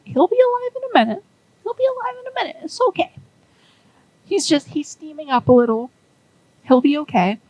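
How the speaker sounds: a jittery builder insisting all is fine; Lucky reassuring. Retold or imitated. Lucky reassuring while Zip steams.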